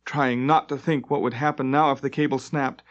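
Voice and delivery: usual speaking voice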